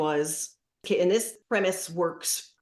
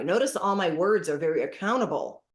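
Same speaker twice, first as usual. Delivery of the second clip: ordinary talk, nothing marked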